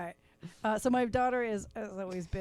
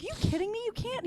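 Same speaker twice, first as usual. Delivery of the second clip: incredulous mom; 13 semitones higher, faster than usual. Retold or imitated. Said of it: "I was like".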